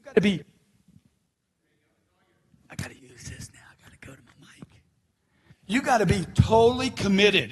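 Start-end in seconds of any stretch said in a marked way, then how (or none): 2.7-4.7 whispered aside. Real, not acted. Aside about switching mics.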